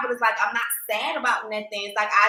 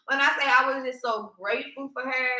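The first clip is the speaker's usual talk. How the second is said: ordinary delivery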